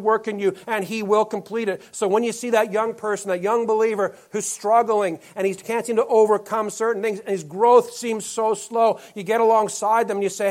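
high, loud, driving sermon voice; preaching at full force. Acted. Pastor urging his congregation to help young believers, loud preaching. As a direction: press it home to the church, full force.

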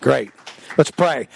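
normal talking voice

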